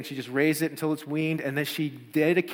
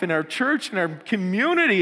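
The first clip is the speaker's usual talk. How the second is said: ordinary delivery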